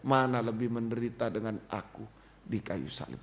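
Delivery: low, subdued question that sinks away; inner pressing. Retold or imitated. Preacher voices Christ's question felt in the heart, low and subdued. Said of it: "Itu tekanan, bukan dengar suara ya".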